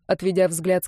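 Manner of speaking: ordinary delivery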